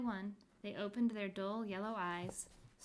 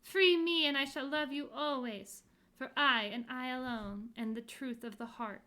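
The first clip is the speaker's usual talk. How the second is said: loud and higher, fading to tender; passionate plea; 4 semitones higher, 9 dB louder than usual. Acted. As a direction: plead with love. Heart voice pleads.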